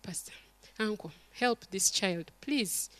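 soft, begging voice; desperate plea. Retold or imitated. Mother begging the pastor to help her child, softly. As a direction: plead with him quietly. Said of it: "I said".

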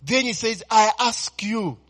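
loud, rising sermon delivery over church music; full-force preaching. Acted. Pastor preaching to his congregation, full-force preaching.

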